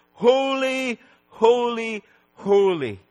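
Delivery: high, sustained cry stepping down. Acted. Preacher quoting the cry in the temple, crying out. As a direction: send each word ringing out. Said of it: "this cry that says".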